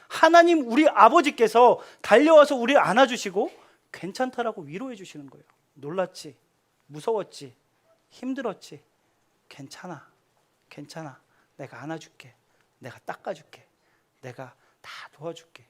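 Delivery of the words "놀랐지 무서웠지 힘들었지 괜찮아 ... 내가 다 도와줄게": very soft, low soothing voice; tender comfort. Pretend. God as a father comforting his frightened children, soft hushed comfort. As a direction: comfort them like a father hugging a scared child.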